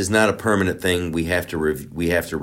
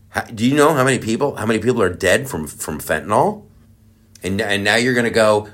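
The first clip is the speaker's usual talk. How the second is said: normal talking voice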